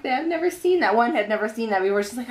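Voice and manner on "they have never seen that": higher-pitched retold disbelief; imitated amazement. Phlebotomists on a baby who didn't cry, mildly imitated amazement. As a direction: lift the quote as they marvelled.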